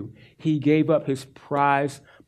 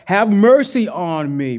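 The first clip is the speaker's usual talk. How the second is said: yelling out; 4 semitones higher, 6 dB louder, slower than usual. Retold or imitated. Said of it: "he yells out".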